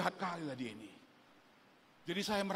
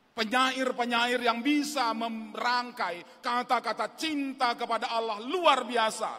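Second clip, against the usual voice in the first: louder, driving sermon delivery; impassioned preaching; 9 semitones higher, 8 dB louder than usual. Acted. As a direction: preach with full conviction.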